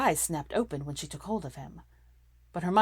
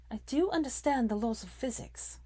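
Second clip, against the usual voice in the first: a curt Hermione snap, pitched higher; snapped; 8 semitones higher than usual. A narrator's reading. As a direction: bite the line off sharply. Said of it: "she snapped".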